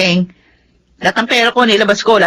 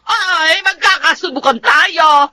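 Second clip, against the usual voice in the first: crying, shouted threat; defiant threat; 5 semitones higher than usual. Acted. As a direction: dare him, then shout it. Wife threatening to report to police.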